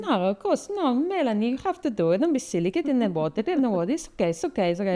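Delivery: put-on high-pitched, sing-song Italian accent; Italian accent, swooping high then settling. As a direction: imitate an Italian instructor coaxing a pupil. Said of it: "It's a really good accent".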